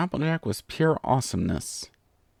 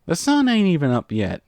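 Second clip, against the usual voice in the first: loud, high-pitched complaint; crying out in protest; 7 semitones higher, 5 dB louder than usual. A narrator's reading. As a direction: exclaim it, woken far too early. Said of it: "Applejack cried, interrupting the Pegasus".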